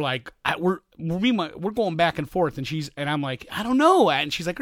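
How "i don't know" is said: high, loud exasperated I don't know; half-laughing high exasperation. Retold or imitated. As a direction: throw up your hands with it. Dad voicing himself, exasperated.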